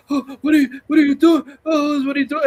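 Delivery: Jean Grey panicking. Retold or imitated. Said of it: "And Jean Grey's like".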